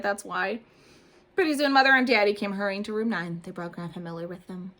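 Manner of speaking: ordinary delivery, nothing marked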